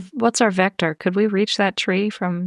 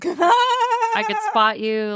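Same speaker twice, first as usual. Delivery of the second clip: eager offer; 12 semitones higher, much slower than usual. Acted. Reassuring her scared partner.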